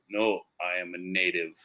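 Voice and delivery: low, quiet joke native voice; deadpan native in a joke, dropped in pitch and volume; native character. Acted. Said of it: "And the native goes".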